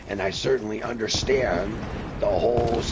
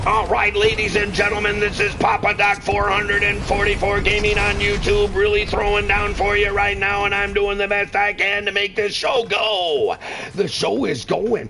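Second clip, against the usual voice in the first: loud, high hype-man announcing; hype announcer; 9 semitones higher, 5 dB louder than usual. Acted. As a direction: announce yourself like a showman. Hyped announcer self-intro.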